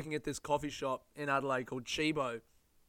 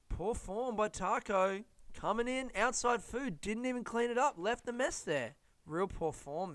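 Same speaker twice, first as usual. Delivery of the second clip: stuffy disapproving mate; 7 semitones higher than usual. Retold or imitated. Older mate complaining to Phil.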